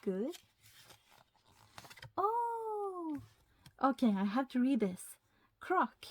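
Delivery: usual speaking voice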